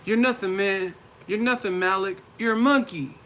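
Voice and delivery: high-pitched mock rapper. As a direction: rap it up high and taunting. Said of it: "he was rapping like [name]".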